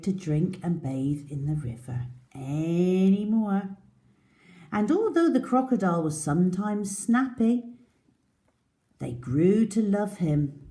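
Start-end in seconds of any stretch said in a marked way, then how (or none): none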